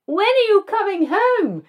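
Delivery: hammed-up heartbroken cry to a stranger; pleading theatrically from a car window; anguished call. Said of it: "in an anguished voice".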